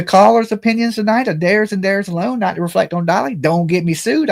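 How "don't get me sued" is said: normal talking voice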